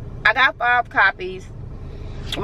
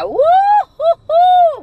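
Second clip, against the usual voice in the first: long, loud high-pitched mock sob; drawn-out "woo hoo hoo" as playful crying; emotional wail; 7 dB louder, much slower than usual. Vlogger moved by her friends, high playful wail. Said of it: "I'm getting emotional".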